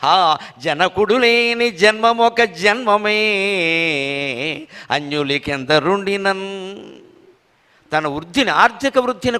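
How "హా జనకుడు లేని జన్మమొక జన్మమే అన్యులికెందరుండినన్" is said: slow, chanted poem line; verse recitation. Acted. Reciting the verse on losing a father, slow chant. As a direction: draw out the lament verse.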